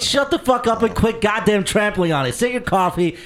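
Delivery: hammed-up angry rant at Johnny; furious shout. Real, not acted. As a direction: blow up at the interrupting co-host. Host yelling at co-host.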